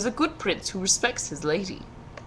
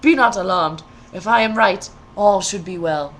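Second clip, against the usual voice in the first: cautioning prince; 8 dB louder than usual. A narrator's reading. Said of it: "he cautions".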